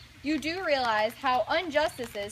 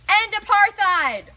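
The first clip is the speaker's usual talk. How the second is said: protest shout; 4 semitones higher, 10 dB louder, slower than usual. Acted. Protest chant.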